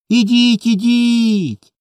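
put-on high, urgent children's call. A narrator's reading. Two boys call mama down from sky, pleading loudly. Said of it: "голосніше кликали хлопці".

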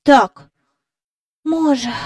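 put-on high boy's voice letting out a sigh; agree, then let it trail into a sigh; soft sighing agreement. A narrator's reading. Michael agreeing with Jane on the way home, softly sighing. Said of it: "тихенько зітхнувши".

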